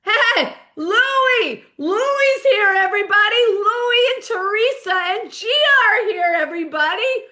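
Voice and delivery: high, loud imitation of a comic hailing guests; excited stage shout. Comedian spots family in his audience, excited shouted announcement. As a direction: holler it out to the whole club.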